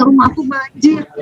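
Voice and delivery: mild mournful complaint; sad telling. Retold or imitated. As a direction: sigh it out like a small disaster. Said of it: "tuh sedih ceritanya". (keluhan sedih yang lembut)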